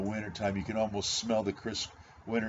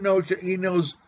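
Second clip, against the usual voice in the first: ordinary talk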